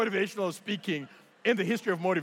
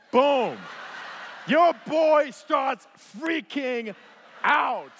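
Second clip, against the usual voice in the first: loud, high, drawn-out words; big comic announcement; 6 semitones higher, 5 dB louder, much slower than usual. Real, not acted. Preacher revealing the photo of his freak-out, booming. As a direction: shout it with long pauses for effect. Showing picture of his panic.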